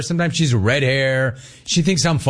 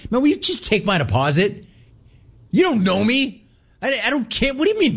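exasperated; 9 semitones higher than usual. Real, not acted. Ranting at bank teller.

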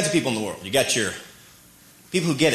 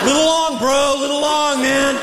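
calling out; 9 semitones higher, slower than usual. Pretend. Commenting on the throw.

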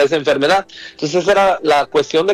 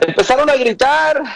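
raised preaching voice; 6 semitones higher than usual. Acted. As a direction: proclaim it to the congregation. Repeats phrase, low then high.

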